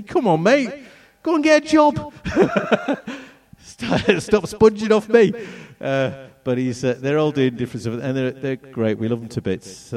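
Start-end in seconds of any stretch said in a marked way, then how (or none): 0.0-2.1 much higher, louder hammed-up fed-up dad; mock exasperation. Dad teasing his son for eight years at university, hammed-up exasperation. 2.2-3.2 a high burst of laughter. Real, not acted. Dad laughing at his own joke about his son. 3.6-5.3 much higher, louder hammed-up fed-up dad; mock exasperation. Dad teasing his son for eight years at university, hammed-up exasperation.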